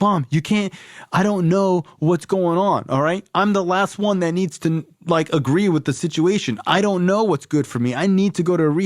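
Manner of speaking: high, urgent begging; desperate high-pitched plea; high pleading. Retold or imitated. Pleading with mom.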